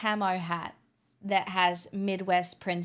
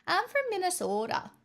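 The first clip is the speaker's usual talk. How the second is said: Minnesotan attempt pitched up high, drifting British; a badly faked Minnesotan accent, much higher; failed Minnesotan accent; 9 semitones higher than usual. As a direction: overdo the Minnesota accent until it sounds British. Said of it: "It's, like, British".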